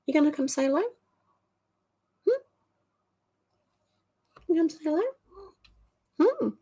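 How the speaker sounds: high sing-song pet talk; high coaxing voice to a pet cat. Real, not acted. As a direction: call the cat sweetly, way up high. Coaxing his cat to say hello.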